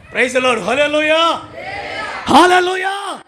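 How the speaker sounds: high, loud, slowed preaching shout; loud, high, slowed fire-and-brimstone preaching to the congregation; fiery preaching. Acted. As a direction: preach it out at full force. Preaching call.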